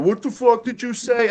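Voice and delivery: high-pitched mock confrontation; acted angry challenge. Driver gets out to confront Sam, high mock challenge. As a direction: imitate an affronted guy picking a fight.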